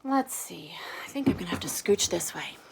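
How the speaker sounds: whispered aside to herself. Real, not acted. Teacher whispering to herself while shifting seat, whisper. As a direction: breathe it out under her breath.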